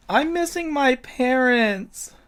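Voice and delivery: put-on high, slow little-kid whine; crying little boy. Game master as lost boy pleading for help, tearful child voice. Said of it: "an eight-year-old boy, and he seems to be crying".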